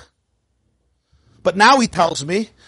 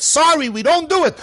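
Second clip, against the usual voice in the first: higher, brisk refusal; curt clerk; 7 semitones higher than usual. Acted. Airport clerk refusing the traveller, higher and curt. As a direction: brush the traveller off.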